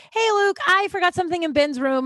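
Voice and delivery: high, breezy pretend greeting; casual call-out. Calls out casually to roommate.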